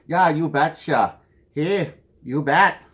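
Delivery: light mock Minnesota accent, slow; a playful hint of Minnesota drawl on "you betcha". Vlogger showing off his home state's accent, lightly mocking. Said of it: "Everybody makes fun of that Minnesota accent".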